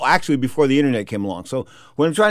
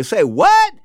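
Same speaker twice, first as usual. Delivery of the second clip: high-pitched, incredulous retold outburst; shocked disbelief; 3 dB louder than usual. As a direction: shoot the question up in mock shock. Retold shock at bad news.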